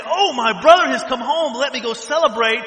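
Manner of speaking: loud, gushing joy at a brother's return; excited welcome. Retold or imitated. Preacher voicing a glad brother, excited and loud. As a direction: cheer like the prodigal is home. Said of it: "instead of him being excited and saying".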